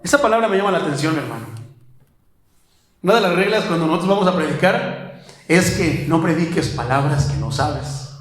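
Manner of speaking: ordinary delivery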